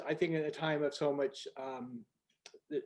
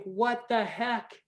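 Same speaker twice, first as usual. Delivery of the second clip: slow, higher, incredulous inner voice; higher and slow, stunned you-can't-be-serious; exasperated disbelief; 7 semitones higher, slower than usual. Retold or imitated. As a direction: drag out the disbelief at their blindness. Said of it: "And you think".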